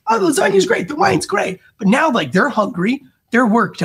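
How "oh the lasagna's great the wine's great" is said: very high, sing-song praise; gushing guest. Retold or imitated. Full guests politely praising the lasagna, high sing-song. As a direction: toss off a guest's high compliment. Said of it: "They would be like".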